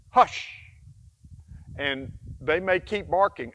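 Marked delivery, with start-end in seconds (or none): 0.1-0.6 sharp, high, loud hush; firm hush. Shown as a demonstration. Trainer telling a barking dog to hush, loud and sharp. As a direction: snap out the command. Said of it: "you use the hand signal".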